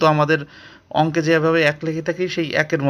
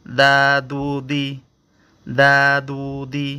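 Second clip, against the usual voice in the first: দা দু দি said slowly, each syllable apart; slow Arabic syllable drill; 4 semitones lower, slower than usual. Shown as a demonstration. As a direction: spell it out slowly for learners. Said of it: "এখানে দেখুন".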